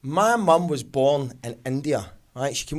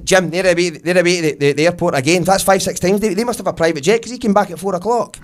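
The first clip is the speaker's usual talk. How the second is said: loud, agog Glasgow neighbour; raised-voice neighbourly gossip; 7 dB louder than usual. Acted. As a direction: call it out to your husband, amazed.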